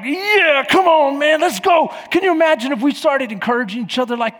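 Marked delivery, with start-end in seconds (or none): 0.0-1.9 loud high pumped-up shout; hyped teammate. Retold or imitated. Players pumping each other up, loud excited shout. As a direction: fire up the team like a locker room. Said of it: "slapping each other on the butt like".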